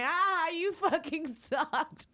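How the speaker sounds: high, slow sneering jeer; slow high mocking sneer. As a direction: drag it out to taunt. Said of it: "She's mocking me".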